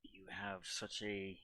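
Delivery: raspy stage whisper. Acted. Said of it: "stage whisper voice".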